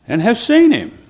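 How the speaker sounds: high, loud proclamation; preached emphasis. Retold or imitated. Preacher declaring Jesus' words to the congregation, high and loud. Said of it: "he said".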